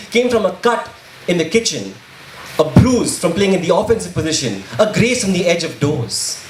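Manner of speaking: spoken-word poem. Acted. Poet accusing those who want blood, impassioned spoken word. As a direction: perform it as a driving, emphatic protest poem.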